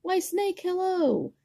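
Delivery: very high, bright greeting; cheery mouse. Acted. Mouse greeting Snake, very high cheery hello. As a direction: sing out a sweet hello. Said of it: "said Mouse".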